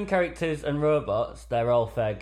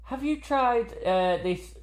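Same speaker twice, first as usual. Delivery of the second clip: high, sing-song helpful voice; imitating a helpful mum; 11 semitones higher, slower than usual. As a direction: chirp in like an eager forum mum. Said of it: "pop in and go".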